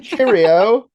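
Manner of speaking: loud high mock British farewell; British accent. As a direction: belt it like a hammy Brit. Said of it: "That's all I got".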